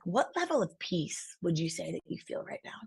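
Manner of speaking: imitated hushed, low, intense interviewer; soft probing question. Podcast guest turns a deep question on her, hushed. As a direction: ask it very softly, as if looking into a soul.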